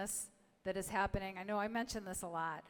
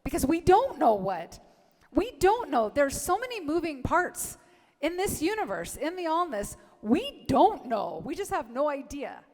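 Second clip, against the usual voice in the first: loud, higher, insistent preaching; fervent preaching; 5 semitones higher, 9 dB louder than usual. Acted. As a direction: build to a loud, high preaching peak on "don't". Preaching emphatically.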